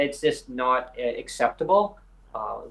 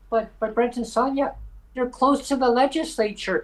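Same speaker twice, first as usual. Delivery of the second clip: much higher, sing-song mock politician; 11 semitones higher than usual. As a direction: parody the MLAs in a high patronizing voice. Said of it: "the MLAs always say".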